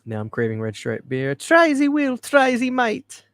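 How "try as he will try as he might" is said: high, loud hammed-up Jamaican accent. Host mimicking the funny shirt promo clip, mock Jamaican accent. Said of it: "It's too funny with the Jamaican accent".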